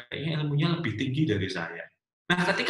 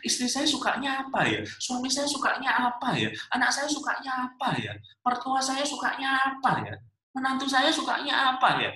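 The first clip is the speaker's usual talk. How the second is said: puzzled guessing; 10 semitones higher than usual. Acted. Preacher mimics family members left guessing about each other, puzzled. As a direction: list each guess in the same high wail, falling at the end.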